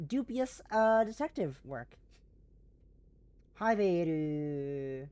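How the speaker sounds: normal talking voice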